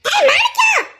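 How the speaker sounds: high shout across distance; calling out. Acted. Calling out to Manikkam. (உரக்க உயர்ந்த குரலில் அழைத்தல்)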